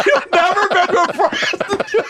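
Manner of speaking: slow, high and laughing; amused disbelief. Real, not acted. Host stunned by Frank's denial, amused disbelief. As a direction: marvel at it through laughter.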